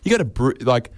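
ordinary delivery

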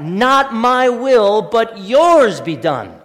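very slow, weighing "Not my will, but yours be done."; slow emphatic quote. Shown as a demonstration. Pastor repeating the prayer slowly for the congregation. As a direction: dwell on each word. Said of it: "Think about that".

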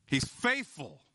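ordinary talk

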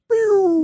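high, loud cartoon pew; comic sound effect for a blank mind; brain going pew. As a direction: zap it out like a brain switching off. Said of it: "My brain just went like".

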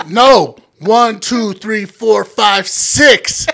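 exasperated shouted correction; yelled number by number; loud as hell. Retold or imitated. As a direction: count it out high and loud. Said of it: "Loud as fuck".

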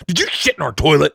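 mock-aggressive confrontation, punching the words; aggressive accuser. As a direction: imitate a man accusing someone point-blank. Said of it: "really aggressive, and he's like".

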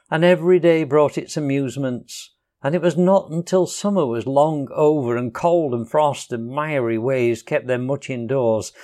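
plain reading voice

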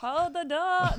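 high, loud cry of hold the door; mock Hodor cry. Mimicking Hodor scene cry.

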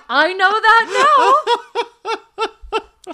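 high, drawn-out comic wail; exasperated. Retold or imitated. Hiker answering the locals' late warning about bears, exasperated. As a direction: stretch it out loud in mock exasperation.

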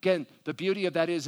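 ordinary talk

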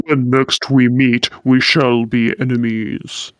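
low, measured character voice; low villain voice. Acted. He-Man vows to meet as enemies, low character voice. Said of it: "Does he sound something like that in Japanese?".

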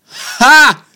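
high loud gotcha ha; mock triumphant laugh. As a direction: bark one smug laugh.

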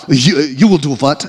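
halting, badly faked Ukrainian accent. Comedian president answering the rescue offer, bad Ukrainian accent. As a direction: put on a clumsy Ukrainian accent. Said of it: "that's not a good accent".

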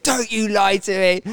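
high, forceful mock shout; retold angry shout. Angry trainer accusing a colleague of lying, mock shouting. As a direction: imitate a furious man rejecting a lie. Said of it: "He starts shouting at me".

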